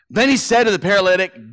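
usual speaking voice

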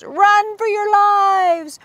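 high, slow frantic shriek; terrified mice soldiers scatter; panicked cry. Acted. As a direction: flee screaming. Soldiers flee the monster.

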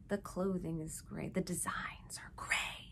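sinking to a gloomy whisper; whispered fade. Real, not acted. Describing the gray company, fading to whisper. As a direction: let each line die away to a whisper. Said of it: "dramatic fade on gray".

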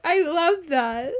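high, gushing excitement; squealing delight. Real, not acted. Gushing over a cute laugh.

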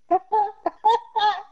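high-pitched burst of laughter. Real, not acted. Laughing at the other player mid-argument, high laughter.

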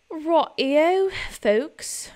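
a sudden put-on British cheer; chirpy fake British greeting; mock British. Vlogger slipping into a joke British accent. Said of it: "I'm not British".

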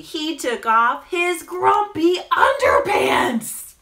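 stretched words building to loud comic punchlines; children's story narration. A narrator's reading. Storyteller undressing the grumpy penguin for children, animated narration. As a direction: draw it out, then hit the silly word. Reads picture book to children.

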